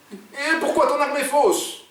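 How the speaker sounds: very high, drawn-out alarmed question; panicked. A narrator's reading. Oumar turns to his partner, panicked. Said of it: "En panique, Oumar se tourne vers Jean".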